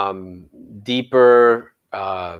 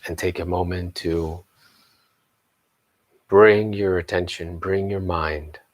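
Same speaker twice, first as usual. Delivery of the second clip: slow, low meditation voice with long pauses; guided meditation; 3 semitones lower, 3 dB quieter than usual. Acted. Teacher leading the morning group into meditation, slow and hushed guided-meditation voice. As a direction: settle into slow, lowered, spacious guidance.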